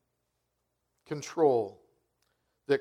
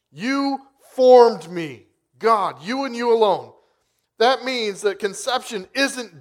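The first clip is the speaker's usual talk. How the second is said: louder, higher fiery preaching with stretched words; preaching at full force; 4 semitones higher, 5 dB louder than usual. Acted. Pastor preaching to the congregation, loud and fervent. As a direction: drive home that God alone formed us. Preaching God alone formed life.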